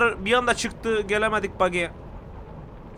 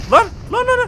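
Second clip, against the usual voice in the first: startled panic; 13 semitones higher, 5 dB louder than usual. Real, not acted. Sudden grenade panic in game.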